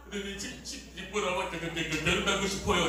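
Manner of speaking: stumbling, stammered proposal; mock stammer. Son-in-law stammering out a marriage proposal, mock stutter. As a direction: trip nervously over the first syllable. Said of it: "말을 더듬었던".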